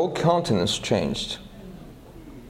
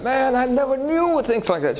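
high excited quote of the woman; woman marveling, voiced high; excited woman quoted; 11 semitones higher than usual. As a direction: play her amazed, pitched up.

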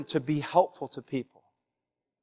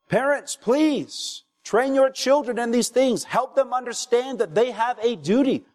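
fiery preaching; 6 semitones higher than usual. Acted. Pastor pleading with parents at full preaching force. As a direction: rise into a passionate plea to the congregation.

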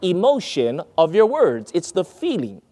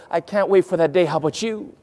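normal talking voice

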